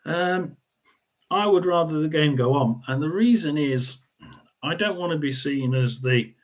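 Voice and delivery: usual speaking voice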